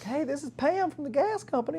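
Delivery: high-pitched imitation of a lady caller; gas company lady. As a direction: mimic her asking for a customer by phone. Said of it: "the lady's like".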